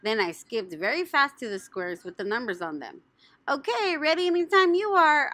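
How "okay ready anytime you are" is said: high, loud eager call; yelled. Acted. Junie B yelling to the cake lady. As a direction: shout it out, impatient to start. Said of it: "I yelled".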